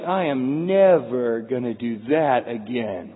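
a hint of shell-shocked misery; traumatized patient. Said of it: "you're like".

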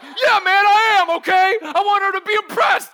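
high, shouted mock admission; exasperated confession. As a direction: blurt it at full volume as a cornered buddy. Buddy's exasperated confession, retold.

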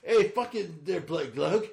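badly faked Aussie banter; bad Australian accent. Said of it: "neither one of them are doing Australian".

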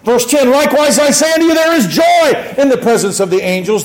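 high, ringing scripture proclamation; fervent preaching. Acted. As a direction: proclaim the verse to the congregation. Preaches scripture at full force.

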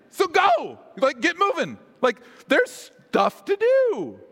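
high-pitched rousing order; insistent, high call to action; urgent command. Acted. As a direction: push them out the door.

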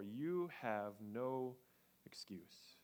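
whispered, slow and grave; a grave hushed warning. Acted. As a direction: drop to a solemn whisper. Dramatic reading of Paul's words.

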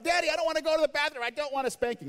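high-pitched, fast imitation of a yelling child; mock child yelling. Child embarrassing dad in public, mock yelling in a high voice. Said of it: "she would start yelling out".

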